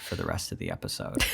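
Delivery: low, slightly hushed hot voice; lightly put-on sultry podcaster; sexy voice. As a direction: play it hot, low and easy. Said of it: "I'm gonna be hot".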